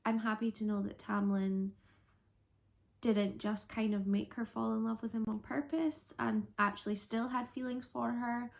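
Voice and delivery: normal talking voice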